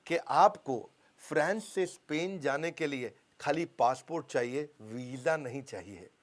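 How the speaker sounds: slowly spelling out the slogan; slow slogan read. Retold or imitated. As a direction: read the ad line slow and clear. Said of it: "slogan क्या लगा दिया".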